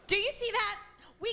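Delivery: high, loud fed-up cry; exasperated outburst. Acted. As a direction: demand the pastor look. Wife exasperated.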